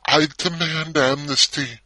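loud, high, slow defiant cry; a gymnast's drawn-out shouted demand. A narrator's reading. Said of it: "and shouted".

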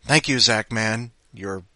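usual speaking voice

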